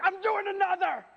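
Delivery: high, loud burst of gym bravado; pumped-up boast. Retold or imitated. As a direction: yell it like nothing can stop you. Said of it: "I'm like".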